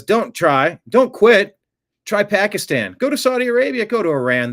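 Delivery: loud, high ranting read; mock yell. Host reading a viewer's angry comment as a loud high rant. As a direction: bark the comment like an angry troll.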